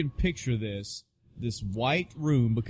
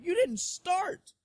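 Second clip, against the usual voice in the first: incredulous protest; 12 semitones higher than usual. Retold or imitated. Patient protesting to the doctor that he never started. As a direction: protest like you were cheated of it.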